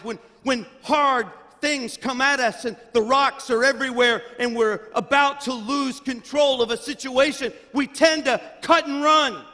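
preach, swelling toward the end; a mild build of fervent preaching; building sermon. Acted.

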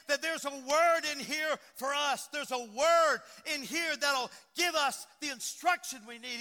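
loud, high sermon exhortation; fervent preaching. Real, not acted. Preacher exhorts church to heed the word, loud and fervent. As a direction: preach it out forcefully.

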